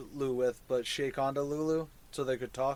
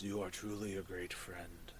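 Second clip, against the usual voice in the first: whispered mushroom creature; 8 semitones lower, 14 dB quieter than usual. Acted. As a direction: breathe the words unvoiced. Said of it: "and says".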